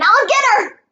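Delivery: high-pitched eager holler; high, excited battle cry; yell. A narrator's reading. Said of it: "he yelled again".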